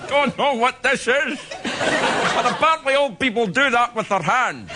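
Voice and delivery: high, slow, hammed-up old man; senior Bond. Comedian imagining Bond at seventy-four, slow high old-man voice. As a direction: draw the words out like a doddery elderly Bond. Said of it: "Senior Bond".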